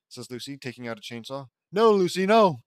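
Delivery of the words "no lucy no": loud, high, panicked cry; panicked yell. Acted. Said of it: "yells Rita, panicking and flinching".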